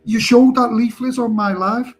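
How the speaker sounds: mock outraged streamer; retold accusation. Retold heated argument.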